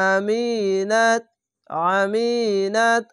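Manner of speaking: আমিনাত drawn out slowly in chant; slow tajwid chant of আমিনাত; slow Quranic recitation. Shown as a demonstration. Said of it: "এরকমভাবে উচ্চারণ করবেন".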